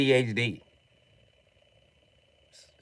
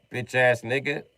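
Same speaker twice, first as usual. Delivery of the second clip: usual speaking voice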